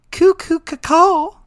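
slow, high mock raven caw; goofy team mascot cry; bird call. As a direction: squawk it like a cartoon bird.

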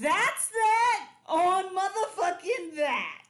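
loud, high, drawn-out proclamation; emphatic hype shout. Real, not acted. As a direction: belt it out slowly like a triumphant verdict. Hypes the video just played.

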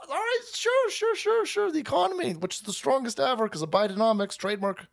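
squeaky put-on brush-off; high mock economist voice. Economist brushing off critics, voiced high. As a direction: chirp it high like a dismissive economist. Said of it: "He then comes back and goes".

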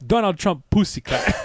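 high, loud fake Jamaican; over-the-top Jamaican patois; Jamaican accent. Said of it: "do a Jamaican accent".

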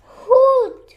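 high, very loud owl hoot; frantic hoot. Acted. Owl too frantic for words, panicked hoot. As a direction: hoot loudly in owlish panic. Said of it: "too frantic to say anything else".

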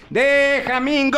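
high, drawn-out fan yell; slow, high mock holler; retold yell. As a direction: imitate your own shouting, stretching each word. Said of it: "yo gritaba".

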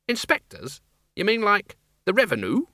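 high, disbelieving echo; can't believe what she heard; incredulous. Acted. Flitworth echoes in disbelief.

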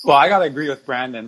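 ordinary delivery

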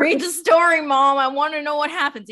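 put-on high, loud whiny kid begging mom to read on; pleading child. Young girl begs her crying mom to keep reading, high whiny plea. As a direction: beg like a little kid who must know the ending. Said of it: "my mom would cry and be like".

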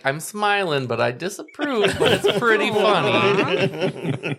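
high, loud voice breaking into laughter; smiling disapproval. Retold or imitated. Grandma reacting to off-color remarks, amused but disapproving. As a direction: imitate amused disapproval through a smile. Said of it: "make this, like, face".

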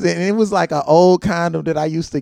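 ordinary talk, nothing marked

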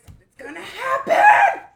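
high panicked shout; acted freak-out, very loud and shrieked. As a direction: yell it in a hammed-up panic. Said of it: "I'm freaking out".

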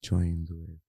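slow, soft, breathy podcast greeting; ASMR voice. Pretend. [name] performing an ASMR intro on request, slow and soft. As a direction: ease into it like an ASMR video. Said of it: "let's hear it anyway".

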